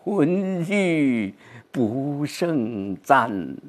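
very slow, fading recital; 缓慢低回地朗诵; poetry recitation. Shown as a demonstration. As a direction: let it sink, drawn out. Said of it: "就是自己的这个变化".

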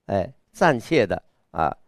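ordinary talk

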